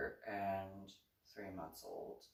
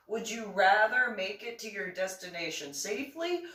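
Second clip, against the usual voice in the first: raised rhetorical question; 10 semitones higher, 8 dB louder than usual. Real, not acted.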